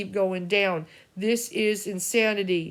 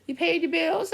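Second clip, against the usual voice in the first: higher mock voice of the son asking; imitating worried son; 9 semitones higher than usual. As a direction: play the nagging son, pitched up. Mother voices her worried son.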